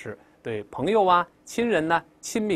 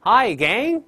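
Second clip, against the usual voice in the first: Hi Gang higher and louder, bright rise on Gang; cheerful greeting; 9 semitones higher, 6 dB louder than usual. Shown as a demonstration. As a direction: greet the whole gang with a lively lift. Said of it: "再来一遍". (热情地大声问候Hi Gang)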